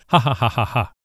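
a plain "ha ha ha" to show the open A; mock laughter. Shown as a demonstration. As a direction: laugh out the Spanish A sound. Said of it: "the A as the A in the sound of laughter".

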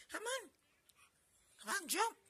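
high, excited urging; puppy talk. Real, not acted. Owner urging puppy to jump, high puppy talk.

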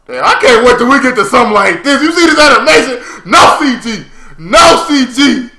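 high, loud hyped-up yelling; excited shouting. Real, not acted. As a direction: rave at the top of your voice. Thrilled by the animation.

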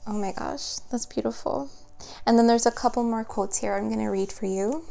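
ordinary delivery

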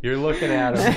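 mock defiant.

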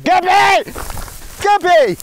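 high, loud sharp call. Real, not acted. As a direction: yell her name to call her back. Calling the lioness sharply.

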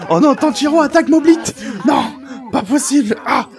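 loud, high panicked cry; alarmed shout. Acted. Zenitsu shouting as Tanjiro attacks, panicked shout. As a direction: yell the alarm as the fight starts.

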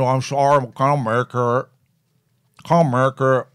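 loud Northern Irish put-on voice; Northern Irish accent.

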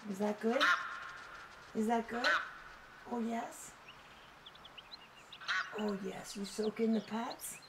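very soft murmuring to the cat; hushed pet talk. Real, not acted. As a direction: croon quietly while petting.

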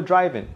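"driven" wrongly said "DRAI-ven"; wrong vowel. Shown as a demonstration. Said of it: "No sé, no es correcto".

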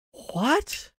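high rising squeak of disbelief; high-pitched baffled question; utter bewilderment. Real, not acted. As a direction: squeak it upward, completely lost. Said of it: "all I can think is".

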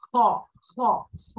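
"খ" said thick and heavy; separate, heavy throaty "খ" sounds; thick kha. Shown as a demonstration. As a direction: make each "খ" full and thick. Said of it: "একটু মোটা করে।".

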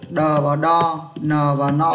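slow, even "Đờ và đo, nờ và no"; spelling out syllables. Shown as a demonstration. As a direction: sound the letter, then the syllable: đờ, đo, nờ, no. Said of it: "các bạn thêm chữ cờ và các bạn có chữ co". (đánh vần chậm rãi "đờ và đo, nờ và no")

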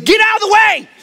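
high acted yell; sudden high-pitched angry outburst; irritated shout. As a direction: shout someone out of your path. Snapping at wife after bump.

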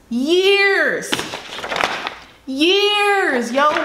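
high, disbelieving cry of years; incredulous. Real, not acted. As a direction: throw up your hands in disbelief. Incredulous repeated outburst.

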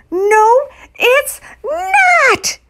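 put-on high, loud childish shout. Ruthie shouting at Martin before running off, loud. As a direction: yell it at Martin. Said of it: "shouted Ruthie".

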